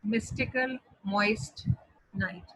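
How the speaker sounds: "Mystical moist night" said slowly, word by word; deliberate, spaced-out demonstration=3; alliteration example. Shown as a demonstration. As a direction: separate each word for the class. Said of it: "This is an example of alliteration".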